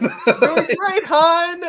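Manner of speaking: high, loud call with a laugh in it; hammed-up encouragement, laughing as you shout; mock cheerful call. Peter calling to MJ as Rhino chases her, mock cheerful.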